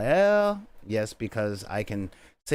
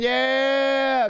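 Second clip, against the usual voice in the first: excited cheer; 12 semitones higher, 5 dB louder than usual. Real, not acted. Hyping up the chat.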